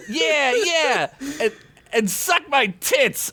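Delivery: high laughing jeer; laughing taunt. Acted. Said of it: "Ugla replied".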